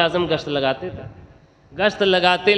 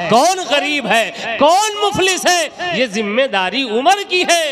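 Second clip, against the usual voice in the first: indignant complaint; 6 semitones higher than usual. Acted. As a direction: rail at the caliph's neglect. Widow complains against the caliph.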